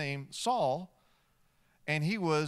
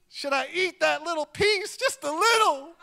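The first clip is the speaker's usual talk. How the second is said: high, loud, coaxing little voice; a hungry man's high wheedling temptation; tempted inner voice; 12 semitones higher, 7 dB louder than usual. Acted. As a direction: sweet-talk yourself into one bite. Voicing his own temptation.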